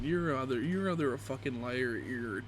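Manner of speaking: usual speaking voice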